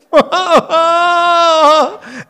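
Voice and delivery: loud high burst of laughter; big laugh. Real, not acted. Preacher laughing at the congregation about paid criers.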